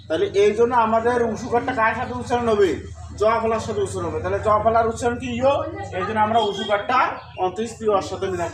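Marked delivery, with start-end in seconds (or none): none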